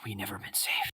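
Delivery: a trembling whisper, fading to breath; a scared, half-mad old man whispering his fear; whispering in terror; frightened hermit. Acted. GM voices shivering hermit.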